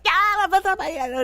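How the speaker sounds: a big, loud, high burst of "Yeah"; excited yeah. Retold or imitated. Speaker remembering her own gushing reaction, loudly excited. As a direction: she blurts it out with no filter at all.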